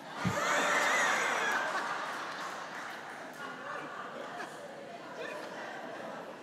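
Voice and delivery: big burst of high laughter. Real, not acted. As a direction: laugh out at the punchline. Punchline laugh.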